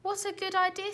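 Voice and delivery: high, eager exclamation; Zog's happy cry; eager delight. A narrator's reading. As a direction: jump at the idea. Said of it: "said Zog".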